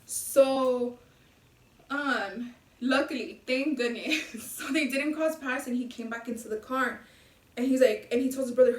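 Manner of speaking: ordinary talk, nothing marked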